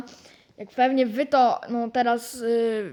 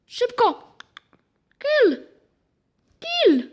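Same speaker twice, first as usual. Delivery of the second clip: excited shouts; 11 semitones higher, much slower than usual. Real, not acted. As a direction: yell them to go for the kill. Excited calls to finish opponent.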